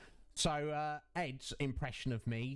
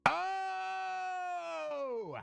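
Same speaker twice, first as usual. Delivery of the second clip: excited greeting; 14 semitones higher, much slower than usual. Real, not acted. Greeting a viewer with a shout.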